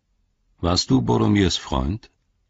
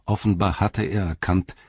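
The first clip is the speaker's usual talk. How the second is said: ordinary delivery, nothing marked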